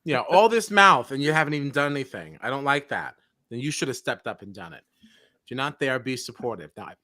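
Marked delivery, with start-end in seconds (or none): 0.0-1.1 louder, slow and exasperated; fed-up jab at a loud talker; exasperated emphasis. Real, not acted. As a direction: lean hard on all this mouth. Venting at Derek.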